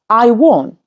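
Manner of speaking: usual speaking voice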